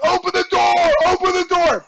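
high-pitched mock shout to the driver; retold urgent yell. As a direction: re-enact a desperate call to open up. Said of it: "I yell to the bus driver".

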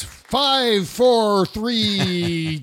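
slow, high, drawn-out count; mock countdown. Host mimicking favorite part of the montage, slow high countdown. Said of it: "My favorite part was".

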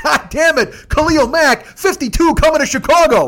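loud, high-pitched ecstatic yell; screaming into phone. Retold or imitated. Fan phoning his friend about a huge trade, screaming. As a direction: scream the big news down the line. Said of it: "I just start screaming into the phone".

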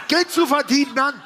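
high, loud protest; indignant outburst. Real, not acted. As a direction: insist over the interruption. Protests while being interrupted.

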